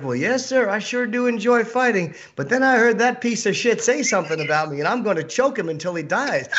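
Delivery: politely eager, keeping it up through the threat; Bryce Mitchell impression. Comic imitating a nice dude who talks about fighting, high polite voice. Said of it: "He says it just like that".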